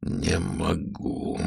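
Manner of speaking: slow, hushed admission; drawn-out, muted giving up; defeated. A narrator's reading. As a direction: let it out slowly and very softly. Hero gives up, defeated.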